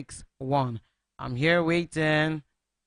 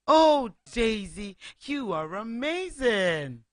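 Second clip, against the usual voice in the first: admiring chickens; 5 semitones higher, slower than usual. Acted. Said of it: "They said".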